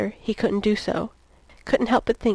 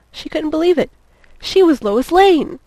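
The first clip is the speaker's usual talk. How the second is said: loud, high exclamation with big falling swoops; indignant self-reproach, voice raised and swooping; exclaiming in disbelief; 8 semitones higher, 8 dB louder than usual. A narrator's reading. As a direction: land hard and high on the name. Thinking in indignant disbelief at herself.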